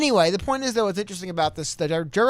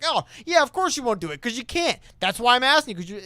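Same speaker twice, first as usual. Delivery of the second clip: higher-pitched schoolyard goading; mock taunting; 5 semitones higher than usual. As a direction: goad them with a mock dare. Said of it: "taunting someone into something".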